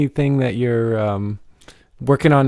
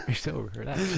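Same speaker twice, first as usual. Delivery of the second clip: laughing; 14 dB quieter than usual. Real, not acted. Teasing laugh.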